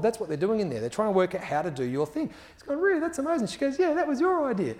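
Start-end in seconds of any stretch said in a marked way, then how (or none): 2.8-3.5 much higher, amazed and pleased; light up in disbelief; a teacher amazed his idea caught on; delighted surprise. Retold or imitated. Alex hears his idea was used. 3.8-4.8 much higher, cheerful confirmation; a colleague happily giving him credit; bright affirming. Retold or imitated. Judith confirming warmly.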